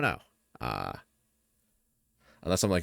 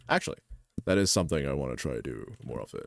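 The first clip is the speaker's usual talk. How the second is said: normal talking voice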